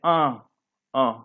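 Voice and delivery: अं sounded through the nose; teacher isolating a nasal vowel; nasal demonstration. Shown as a demonstration. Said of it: "नाक से बोलते हैं".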